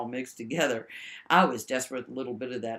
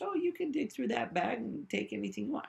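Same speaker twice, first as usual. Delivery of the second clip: mock Jim offer; 9 semitones higher than usual. Mocking Jim's offer.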